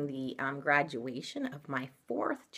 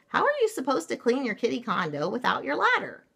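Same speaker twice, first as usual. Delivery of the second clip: scolding mama; 8 semitones higher, 4 dB louder, faster than usual. Acted. Storybook mama scolds the girls.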